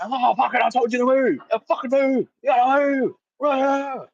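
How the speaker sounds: high, strained mock yelling; hammed-up high-pitched rage, last words drawn out; irate courier. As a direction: imitate a courier ranting in someone's face. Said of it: "had a courier in my face".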